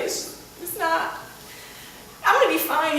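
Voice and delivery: choked, breathy fighting tears; trying to hold it together; a touch of teary strain; holding back tears. Retold or imitated. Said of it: "The only time I cried".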